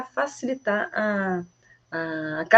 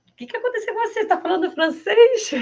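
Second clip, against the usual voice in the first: much higher, astonished; surprised family member; 13 semitones higher than usual. Pretend. Family surprised the parent speaks French. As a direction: react amazed. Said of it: "Aí você fala, "Ué?".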